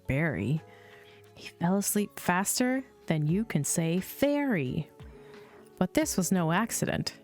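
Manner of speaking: usual speaking voice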